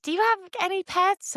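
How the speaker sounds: put-on high-pitched curious kid voice; piping kid's question; little girl. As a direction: imitate a small child asking. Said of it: "hang out with some of the girls".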